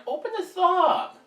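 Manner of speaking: strained high voice. Real, not acted. Struggling to open something.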